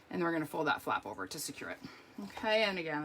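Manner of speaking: usual speaking voice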